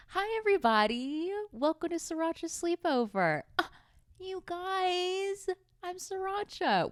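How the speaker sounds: high, bubbly put-on TV host voice. Host welcoming listeners to the sleepover, high put-on bubbly voice. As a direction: gush the welcome in a sugary host voice. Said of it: "Why am I talking like this?".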